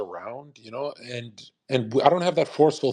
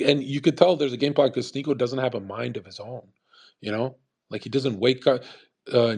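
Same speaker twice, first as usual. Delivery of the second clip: ordinary delivery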